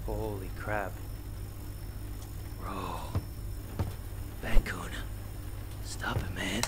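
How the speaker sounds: hushed, low, barely voiced; halting whispers of disbelief; spooked whisper. Real, not acted. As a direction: whisper it, frozen by a scare. Spooked by possessed toy.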